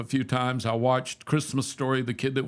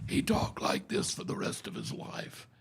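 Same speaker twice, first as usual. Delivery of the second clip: imitating a voiceless, whispery rasp after botched surgery; ruined voice; 6 dB quieter than usual. Man showing how a friend talked after throat surgery, voiceless imitation. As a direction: push it out with no voice, just softer breath. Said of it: "it ruined his voice".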